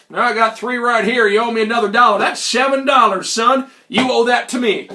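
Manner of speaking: put-on Old Man McGillicuddy. Old hustler demanding money from the visitor, forceful character voice. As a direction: demand payment like a brazen old-timer. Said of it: "Mister McGillicuddy".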